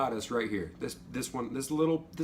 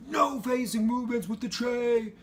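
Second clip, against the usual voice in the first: high, loud barked order; mock drill instructor shouting about the tray; drill instructor yell; 11 semitones higher, 3 dB louder than usual. Retold MTI yelling at him.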